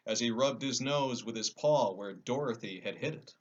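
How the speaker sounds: ordinary delivery, nothing marked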